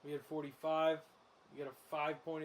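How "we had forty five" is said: normal talking voice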